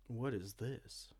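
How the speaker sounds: usual speaking voice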